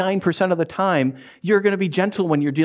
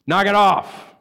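firm, louder knock it off; forceful command; 4 semitones higher, 3 dB louder, slower than usual. Shown as a demonstration. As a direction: bark the order to stop. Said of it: "No, you gotta be forceful".

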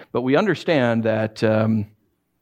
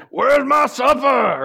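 high, loud, slow mock demand; hammed-up bossy husband wanting supper; rude demand; 11 semitones higher, 4 dB louder, much slower than usual. Shown as a demonstration. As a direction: play the rude husband, drawn out. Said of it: "I'd say something like that".

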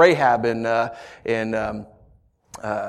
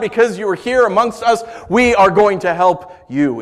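loud, high call to rescue; rally the people like a king; rousing rally speech; 8 semitones higher, 5 dB louder than usual. Retold or imitated. Preacher voicing Saul rallying the people, raised and stirring.

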